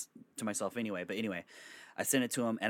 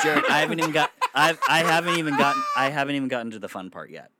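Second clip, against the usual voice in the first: raised, higher voice over the laughter; loud protest; 4 semitones higher, 9 dB louder, faster than usual. Real, not acted. Storyteller to heckling friends who derail the story, loud protest. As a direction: protest loudly, easing off by the end.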